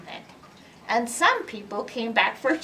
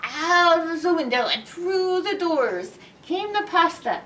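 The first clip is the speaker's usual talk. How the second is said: ordinary delivery, nothing marked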